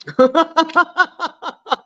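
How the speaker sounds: long trailing cackle; real laugh that tails off in bursts. Real, not acted. Cracking up at own rant.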